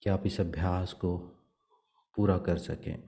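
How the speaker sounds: soft, even meditation voice with long pauses; guided meditation. Acted. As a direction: guide them gently into prayer, leaving long silences. Leads meditation and prayer. (शांत, धीमे स्वर में ध्यान का निर्देशन)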